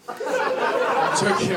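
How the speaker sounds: slow, stretched-out I'm joking through a laugh; drawn-out joking. Acted. Comic telling the crowd he's kidding, slow and laughing. Repeats joke retraction, drawn out.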